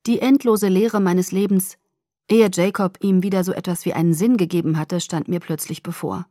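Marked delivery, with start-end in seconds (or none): none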